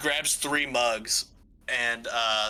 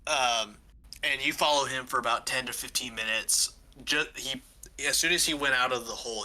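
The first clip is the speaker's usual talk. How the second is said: ordinary talk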